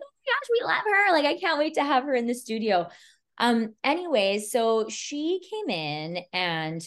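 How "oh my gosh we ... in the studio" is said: high excited squeal of love; mock gushing. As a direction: gush over the client. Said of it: "were like".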